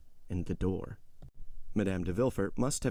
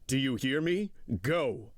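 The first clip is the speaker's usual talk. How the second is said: put-on stern, raised-pitch order to a child; harsh command; 5 semitones higher, slower than usual. A narrator's reading. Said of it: "so harshly that the child started up".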